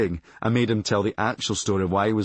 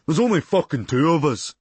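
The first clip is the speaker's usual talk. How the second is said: louder, higher put-on dim lad; dim classmate voice; 5 semitones higher, 4 dB louder than usual. Lab partner scoffing at the narrator, in a put-on dim voice. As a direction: play the dense lab partner, mocking. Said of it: "he scoffed".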